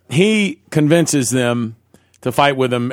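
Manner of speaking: normal talking voice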